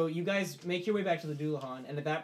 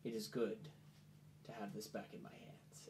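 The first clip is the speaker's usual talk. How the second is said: Arano's quiet satisfaction; 4 semitones lower, 13 dB quieter than usual. Acted. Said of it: "looks at the pommel and goes".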